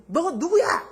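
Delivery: high, loud snapping demand; a rude customer yelling at the part-timer; angry demand. Retold or imitated. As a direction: bark it at him.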